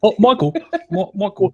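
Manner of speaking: "Michael" lifted high with a broad "oy" vowel; imitated accent. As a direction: tease "Michael" as "Moy-kul". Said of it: "I love the way he says Michael".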